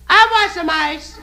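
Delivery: high, slow lady calling down; falsetto woman calling. Acted. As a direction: sing it out from the upper floor. Woman yells down from upstairs.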